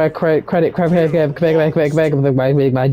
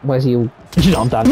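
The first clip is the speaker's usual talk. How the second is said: ordinary delivery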